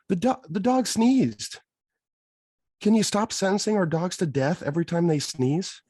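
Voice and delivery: high-pitched, squeaky-high protest; incredulous exasperation. Retold or imitated. Farmer protesting her verdicts on sneezing dogs, incredulous exasperation. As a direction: plead in disbelief, pitch shooting up. Ranting at mother-in-law's overreaction.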